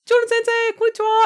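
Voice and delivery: very high, loud mimicked greeting; high teacher voice. Teacher greeting him from her Harley, imitated very high voice. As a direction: chirp it in a tiny high voice. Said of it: "she talks like this when she talks".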